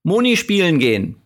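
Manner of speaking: put-on a small child announcing what she wants; toddler's baby talk. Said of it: "danach sagst du".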